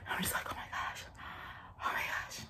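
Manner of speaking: hushed oh my gosh after near miss; stunned whisper. Retold or imitated. Whispering to herself after the taxi nearly hits her. Near crash, frozen in shock.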